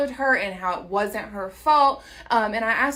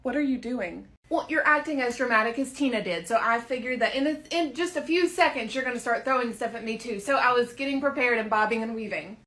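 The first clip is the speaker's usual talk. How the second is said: ordinary delivery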